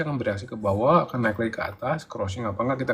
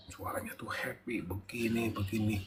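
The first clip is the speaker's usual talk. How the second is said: whispered aside; 8 dB quieter than usual. Real, not acted. Aside about a happy phone voice.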